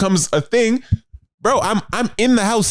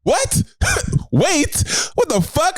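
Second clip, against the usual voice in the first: high-pitched, baffled questions with laughter; hammed-up astonishment, pitch leaping up; mock incredulous; 11 semitones higher, slower than usual. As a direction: squeak out disbelief. Acted baffled reply to her.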